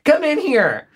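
high sing-song call to the next room; high lilting summons. Real, not acted. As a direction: call him in, sweetly. Calling husband from other room.

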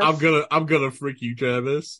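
low mock menace. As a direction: growl it low and quick. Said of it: "give Travis a frick you, but with love".

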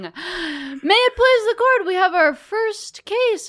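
high, formal court crier; town crier. Acted. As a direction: proclaim it like a court herald. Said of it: "put on your bailiff mask".

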